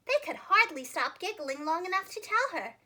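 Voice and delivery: plain reading voice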